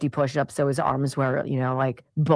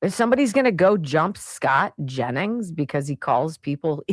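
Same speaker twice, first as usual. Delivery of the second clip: normal talking voice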